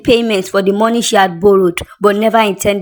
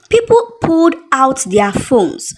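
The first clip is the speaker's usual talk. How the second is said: plain reading voice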